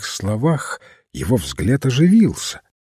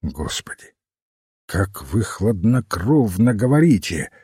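horrified exclamation; 2 semitones lower than usual. A narrator's reading. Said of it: "воскликнул Финс, взглянув на него с ужасом".

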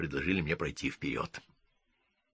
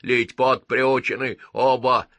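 gruff gnome voice; 5 semitones higher, 5 dB louder than usual. Acted. Gnomes speak in chorus.

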